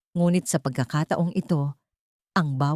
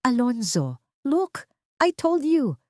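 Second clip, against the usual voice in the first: triumphant accusation; 8 semitones higher, slower than usual. A narrator's reading. Margot accusing Solen to father.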